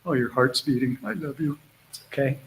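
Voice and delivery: tender hush to dog. Real, not acted. Calming the dog's racing heart.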